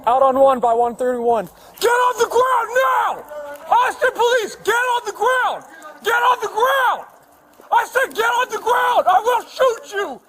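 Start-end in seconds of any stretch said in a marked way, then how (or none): 1.8-10.2 shouted surrender demands. Real, not acted. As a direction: yell it at an armed man. Officer confronting armed suspect.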